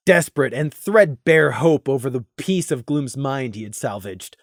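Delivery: ordinary delivery, nothing marked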